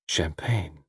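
a low, quiet offer; undertone. Acted. Waiter offering champagne during the song, undertone. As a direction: murmur it discreetly. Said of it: "in an undertone".

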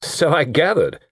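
drily acknowledging an apology; dry. A narrator's reading. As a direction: answer with dry understatement. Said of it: "said Harry Dalehouse dryly".

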